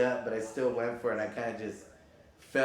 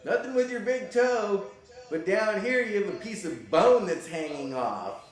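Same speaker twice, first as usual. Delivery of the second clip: higher, louder put-on doctor voice; doctor's verdict; 9 semitones higher, 6 dB louder than usual. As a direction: announce the x-ray result brightly.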